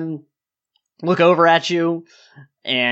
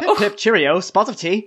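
mock British accent; 6 semitones higher than usual. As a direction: put on a silly, high British accent. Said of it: "what a real British person sounds".

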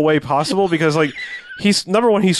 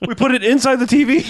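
laughing; 10 semitones higher than usual. Pretend. Jokey apology voiced, laughing.

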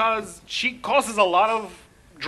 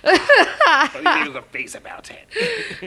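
laughing unvoiced whisper; 10 semitones higher, 6 dB louder, slower than usual. Real, not acted. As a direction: breathe it through a laugh. Sarcastic whispered aside.

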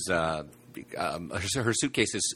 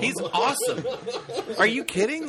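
very high, incredulous defence; outraged fan squeal; indignant rave. Real, not acted. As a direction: protest in a high, excited voice. Protests the others' harsh verdict.

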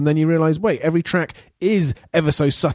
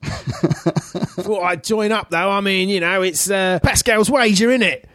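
lightly put-on Cockney, slow start; a hint of a Cockney cabbie, higher; mock Cockney; 5 semitones higher than usual. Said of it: "doing a Cockney".

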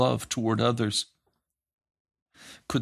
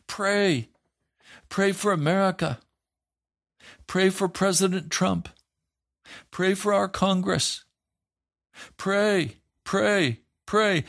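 higher, urgent calls to pray; mock pleading preacher; 9 semitones higher than usual. Pastor mimicking other preachers urging prayer, higher and pleading. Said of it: "You're going to hear them saying".

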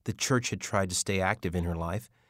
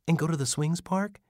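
put-on higher, eager child's question; eager little boy; 9 semitones higher than usual. Little Jacob asks Mitch hopefully, higher child voice. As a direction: sound like a hopeful small kid. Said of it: "Jacob brightened".

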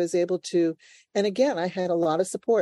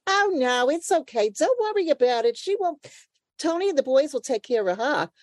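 much higher, soothing neighbor voice; Missus Schwartz; 7 semitones higher than usual. Retold or imitated. [name] voicing the stoop neighbor who calms everyone down, high and cooing. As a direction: coo reassurance like a fussing old neighbor lady. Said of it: "And then you have Missus Schwartz".

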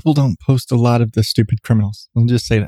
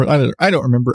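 ordinary talk

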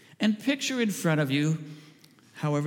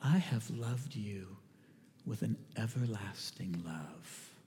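slow and much softer, every word laid down gently; tender voice of Jesus; 9 semitones lower, 10 dB quieter, slower than usual. Acted. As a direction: speak it like Jesus looking deep into your eyes. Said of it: "and he says to you".

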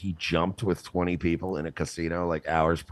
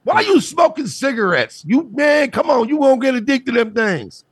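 hammed-up scolding uncle, pitched way up; 8 dB louder than usual.

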